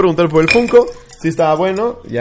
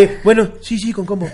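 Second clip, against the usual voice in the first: timid chauffeur; 4 semitones higher than usual. Acted.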